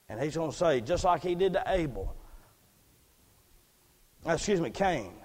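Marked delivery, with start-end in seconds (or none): none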